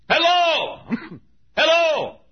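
high, loud long holler; hollering hello. Retold or imitated. Preacher calling to find out if anybody preaches there, high loud holler. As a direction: call out as if ringing the doorbell. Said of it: "hollering hello".

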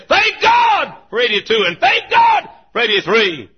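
slow, high shouted praise; future preacher. Retold or imitated. Children grown into preachers, shouting thanks slowly. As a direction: proclaim it loud and drawn out. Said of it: "they'll be preaching like I am".

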